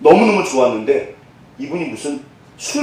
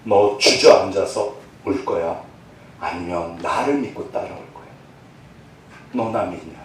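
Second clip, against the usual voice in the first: 1 gentle voice of God; 9 semitones lower, 7 dB quieter than usual. Acted. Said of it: "항상 저에게 하셨던 말씀이세요".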